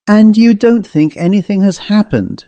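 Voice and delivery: usual speaking voice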